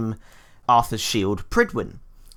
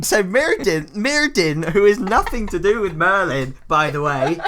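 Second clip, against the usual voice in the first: higher, louder flustered cover-up; 7 semitones higher, 5 dB louder than usual. Acted. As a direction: rush past the blunder, flustered. Covering up the spoiled reveal.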